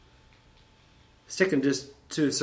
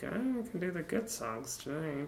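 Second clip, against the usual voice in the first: higher, softer mocking voice; mock quote; 5 semitones higher, 7 dB quieter than usual. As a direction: imitate someone in a high little put-on voice. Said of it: "was like".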